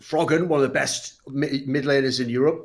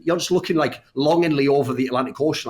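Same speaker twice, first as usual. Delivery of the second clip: usual speaking voice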